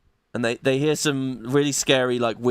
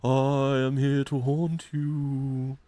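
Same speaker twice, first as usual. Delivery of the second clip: very slow, soft, hollow ghost; eerie slow ghostly whisper-voice; haunting ghost voice; 4 dB quieter, much slower than usual. Acted. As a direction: draw it out like a spirit haunting them. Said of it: "he says".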